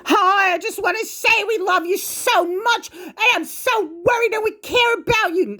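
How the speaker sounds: very high, syrupy mock-caring voice; mocking the mother's fake-caring phone call in a very high voice. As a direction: ham up her fake concern. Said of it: "like my idiotic fucking mother".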